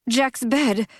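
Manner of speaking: startled exclamation, falling; sudden realization. A narrator's reading. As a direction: it hits him all at once.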